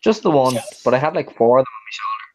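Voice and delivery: normal talking voice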